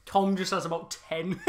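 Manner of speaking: ordinary talk, nothing marked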